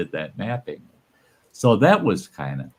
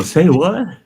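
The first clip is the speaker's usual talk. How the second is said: surprised say what; 7 semitones higher, 4 dB louder than usual. Retold or imitated. His surprise that the artist is her uncle.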